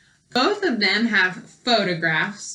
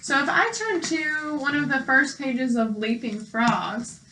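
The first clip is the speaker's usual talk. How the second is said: ordinary talk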